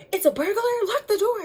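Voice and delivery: urgent fear, get that door locked; frightened order. Retold or imitated. Sister urging lock the door, panicked and loud.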